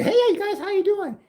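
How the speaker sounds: high, bubbly mock greeting; high sing-song waitress impression; perky waitress. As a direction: chirp it like an overeager server.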